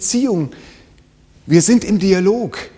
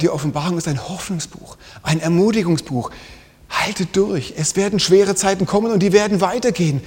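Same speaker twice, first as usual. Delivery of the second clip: ordinary delivery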